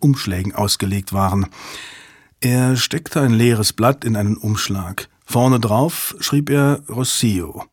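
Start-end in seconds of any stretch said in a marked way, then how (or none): none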